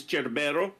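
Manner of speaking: Cerbero as "CHER-beh-ro"; Italian Cerbero, ending on "o"; Italian pronunciation. Shown as a demonstration. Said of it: "the Italian here is".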